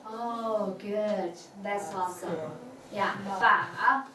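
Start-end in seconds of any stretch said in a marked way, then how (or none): 3.2-4.0 "ba" dipping low, then turning up; dipping third tone. Shown as a demonstration. Teacher models tricky third tone, falling then rising. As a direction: drop "ba" down and bend it back up. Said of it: "That third tone".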